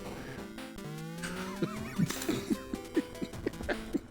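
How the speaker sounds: long, soft, high laugh; helpless laughter. Real, not acted. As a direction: crack up after a rant. Cracks up after yelling.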